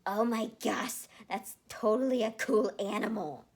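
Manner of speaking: amazed gasp. Acted. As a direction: gush with wonder. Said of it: "Leon gasped".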